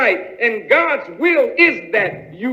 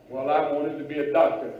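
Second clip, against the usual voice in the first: a lower, fast, resigned reply; disappointed answerer; 10 semitones lower, 7 dB quieter, faster than usual. Acted. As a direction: answer like a let-down man. Preacher acts disappointed answerer.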